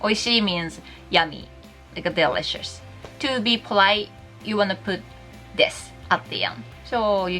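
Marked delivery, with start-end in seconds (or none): none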